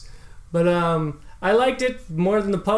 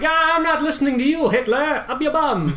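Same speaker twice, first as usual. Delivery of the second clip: put-on old-time British accent; 9 semitones higher than usual. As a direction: play Churchill dismissing Hitler in a mock British voice. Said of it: "That's my British accent".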